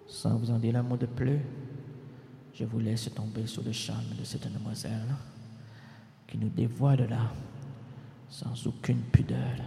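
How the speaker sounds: low, soft, fading towards a whisper; hushed introduction. Pretend. Host redoes the introduction for Julie, hushed low voice. Introduction redone after music, on request.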